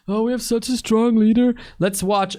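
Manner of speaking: high-pitched sarcastic praise; mock gushing. Mock praise while choosing a video.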